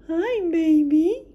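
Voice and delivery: high, loud, drawn-out playful coo; baby-talk greeting. Pretend. Collector greeting her new doll, playful baby talk. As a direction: greet it like a baby, slowly singsong.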